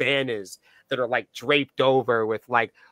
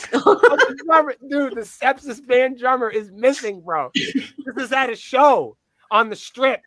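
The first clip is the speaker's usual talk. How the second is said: laughing; 8 semitones higher, 8 dB louder, slower than usual. Real, not acted. Telling the drummer went missing.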